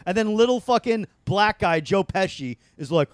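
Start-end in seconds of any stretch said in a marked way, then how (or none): none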